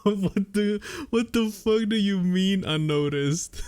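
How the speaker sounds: whiny high mock yell; hammed-up whiny shout; whiny faux shout. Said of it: "whiny faux shout".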